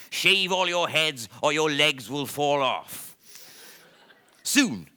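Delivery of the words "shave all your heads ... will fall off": louder, higher threatening shout. Acted. Bald lion racing round the jungle, shouting orders at the lions. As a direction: bellow the order while racing around.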